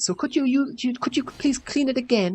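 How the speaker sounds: high mimicked request; imitated roommate. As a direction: mimic the roommate's demand, pitched right up. Retold roommate making demand.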